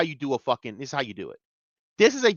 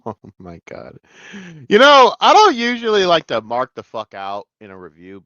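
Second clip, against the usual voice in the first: ordinary talk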